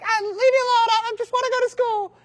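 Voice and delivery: put-on very high, loud little boy; squeaky child begging to be left alone; whiny little kid. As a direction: plead like a scared five-year-old. Small boy begging the bully.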